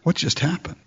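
hushed, awed whisper-like murmur; a quiet stunned prisoner wondering aloud. Retold or imitated. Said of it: "are you just gonna sit back and go".

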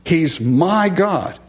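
slow, high "He's MY God", leaning on "my"; stress on my. Shown as a demonstration. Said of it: "David makes that emphatic".

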